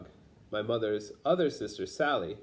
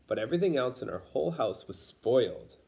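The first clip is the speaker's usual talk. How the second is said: ordinary delivery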